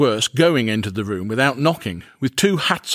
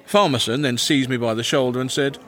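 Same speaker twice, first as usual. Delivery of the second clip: ordinary delivery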